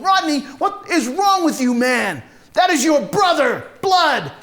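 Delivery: loud, high, incredulous reproach; outraged scolding. Acted. Tommy pulls Rodney off his brother, outraged scolding. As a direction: shout him down in disbelief.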